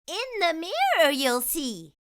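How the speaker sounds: put-on a magical fairy addressing the girl kindly. Fairy greeting the girl, high magical voice. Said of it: "said the fairy".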